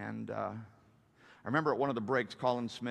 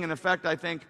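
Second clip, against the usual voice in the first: normal talking voice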